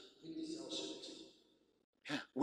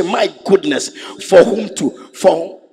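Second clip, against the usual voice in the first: much louder, half sung, ogling wonder; lustful exclamation; 3 semitones higher, 11 dB louder than usual. Retold or imitated. Man ogling a passer-by, loud singsong gush. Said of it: "you say".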